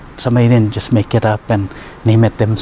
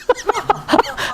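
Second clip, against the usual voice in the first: squealing high laugh; high burst of laughter; 4 dB quieter than usual. Real, not acted. Healer laughing at his own punchline, high burst.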